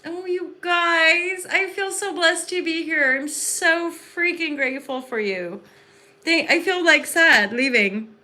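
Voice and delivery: high, slow, overflowing with thanks; gushing gratitude. Real, not acted. Saying goodbye to her viewers, she gushes high and slowly. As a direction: gush like you could cry with joy.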